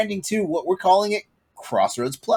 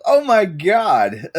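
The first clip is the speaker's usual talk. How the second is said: slow, drawn-out amazement; higher, slowly stunned reaction; 4 semitones higher, 3 dB louder, much slower than usual. Real, not acted. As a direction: stretch each word, floored. Stunned by the long role list.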